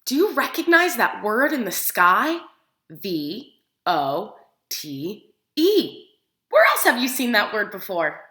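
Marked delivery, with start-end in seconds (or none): 2.9-6.1 low, slow letter by letter; spelled out slowly. Shown as a demonstration. Teacher spelling the word slowly for the children. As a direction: sound out each letter deliberately.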